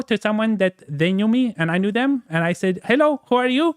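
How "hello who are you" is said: Andy Kaufman voice. Retold or imitated. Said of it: "I arrived at the venue as Andy Kaufman".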